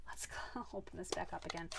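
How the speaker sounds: whispered with a small laugh. Real, not acted. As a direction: whisper it, amused at yourself. Mistake with the ink pad.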